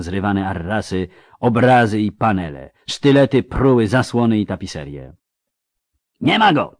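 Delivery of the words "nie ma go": high shouted cry; yell. A narrator's reading. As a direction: yell it to the others. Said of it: "wrzasnął jeden". (wrzask, wysoki i głośny)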